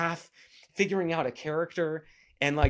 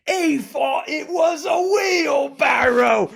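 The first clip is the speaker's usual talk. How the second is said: hammed-up Cockney, shouted much higher and louder; milk the line in an overblown Cockney shout; horrible Cockney accent; 12 semitones higher, 9 dB louder, much slower than usual. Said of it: "horrible Cockney accent".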